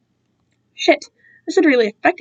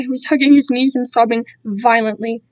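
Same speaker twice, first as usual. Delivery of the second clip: plain reading voice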